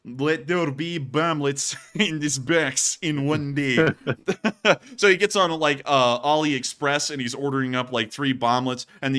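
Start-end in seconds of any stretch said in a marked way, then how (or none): 0.0-3.9 slow, hammed-up Russian accent; mock Russian accent. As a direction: imitate a Russian soldier's thick accent. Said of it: "But right now, [name]'s going".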